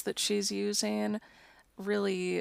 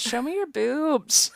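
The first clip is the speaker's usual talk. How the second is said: mock crowd shout, higher and louder; imitated audience heckle; 6 semitones higher, 6 dB louder than usual. Imitating the audience cheering her on at conventions, mock shout. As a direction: call it out like a rowdy heckler. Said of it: "when the audience says".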